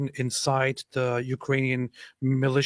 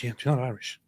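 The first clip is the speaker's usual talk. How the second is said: ordinary talk, nothing marked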